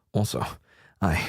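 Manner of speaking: lightly voiced hesitant game hero; halting confession with a hint of the hero voice; Snake. Snake admits liking the new girl, lightly voiced. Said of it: "let me get into the snake voice".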